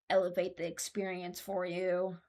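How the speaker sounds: usual speaking voice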